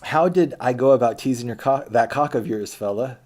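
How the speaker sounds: loud, pointed retort thrown back at him; mock indignant challenge. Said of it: "So she just kinda, like, stared that down".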